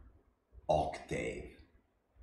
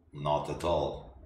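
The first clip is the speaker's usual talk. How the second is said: usual speaking voice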